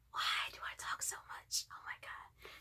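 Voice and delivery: whispered under her breath; self-scolding whisper. Retold or imitated. Vlogger whispering to herself about rambling on. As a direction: hush it as if scolding yourself.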